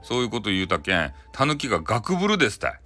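plain reading voice

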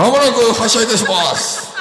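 high, loud platform announcement; loud station announcer. Pretend. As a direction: boom it over the platform. Louder train announcement.

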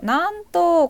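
mock surprise. Real, not acted. Streamer reacting to love talk, high loud playful surprise. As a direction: pop it out in playful astonishment.

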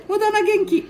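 put-on high, playful little-boy voice; squeaky grandkid voice; child voice. Ken-chan teasing.

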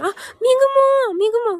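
high, loud happy call of a name; light up as a friend arrives; excited greeting. Real, not acted. Spotting a favourite viewer join, excited.

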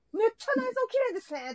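high, loud gushing praise. Shown as a demonstration. Guest planning to praise the decor in front of everyone, loud voice. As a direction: say it loudly so everyone hears. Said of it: "でっかい声で言いますよ".

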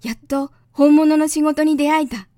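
put-on glad, loud boyish cry; excited young prince. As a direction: lift it with childlike delight. Prince delighted to meet a geographer.

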